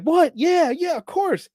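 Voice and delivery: high, eager, mock-thrilled yes; a touch of hammed-up delight; excited kids. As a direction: gush like starstruck kids. Kids accept movie invitation.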